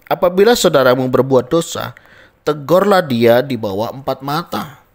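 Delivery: loud, emphatic reading of the verse; scripture quoted. Acted. Preacher reads God's word to the church, loud and emphatic. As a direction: proclaim the verse to the congregation.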